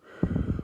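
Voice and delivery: deep, dark, loud breath; Darth Vader breath. Shown as a demonstration. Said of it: "like, Darth Vader".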